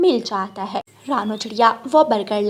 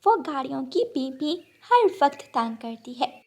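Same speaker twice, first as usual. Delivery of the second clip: softer sparrow voice, coaxing; a hostess sparrow offering parathas; kind sparrow; 3 semitones higher, 5 dB quieter than usual. Acted. (नरम, मनाती चिड़िया की आवाज़)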